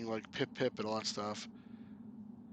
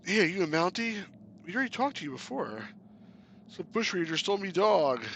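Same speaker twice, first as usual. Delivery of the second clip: character voice; 5 semitones higher than usual. Acted. Voicing game characters.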